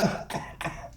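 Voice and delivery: sputtering, half-drowned cough; acted coughing. Drunk surfacing after a dunking, acted sputtering cough. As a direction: cough as if just pulled from water.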